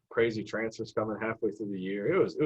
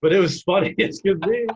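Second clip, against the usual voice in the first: higher, louder, laughing through the words; 9 semitones higher, 8 dB louder than usual. Retold or imitated. Coach teasing the kid, laughing. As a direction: chuckle as you deliver the coach's jab.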